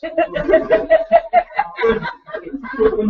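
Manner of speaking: big high burst of laughter; a high shout of a laugh. Real, not acted. Laughs at his own twitching lips.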